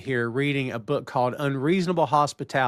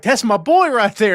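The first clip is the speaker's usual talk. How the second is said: high, loud retold cheer; excited cheer; 9 semitones higher, 9 dB louder than usual. [name] cheering on his colleague in a recap, excited cheer. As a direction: proudly call it out, high and loud.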